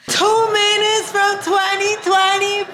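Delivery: high, loud, slow excited call; excited announcement. Real, not acted. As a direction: shout it out thrilled. Calling out before midnight.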